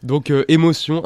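real loud laughter bursting through the words; laughing loudly. Real, not acted. Critic cracks up when his colleagues guess his taste, laughing loudly.

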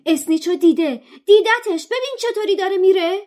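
very high, loud excited shout. Acted. Harry shouting to his friends that the seeker saw the snitch, high loud shout. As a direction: scream it out as he spots the snitch. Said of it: "هری فریاد زد".

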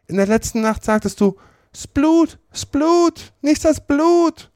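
viel höher, neckend; Sid stichelnd. A narrator's reading. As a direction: necke den Bruder gnadenlos. Sid ahmt Tom nach. (Sid ahmt Toms Reden neckend nach, viel höher)

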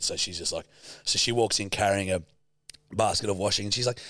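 ordinary talk, nothing marked

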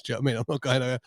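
ordinary talk, nothing marked

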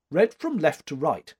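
mildly curt instruction; a touch of curt command. A narrator's reading. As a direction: say it briskly, with authority. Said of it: "she said curtly".

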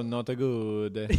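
hammed-up Italian accent on "Not good"; mock Italian accent. Shown as a demonstration. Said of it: "or did you go".

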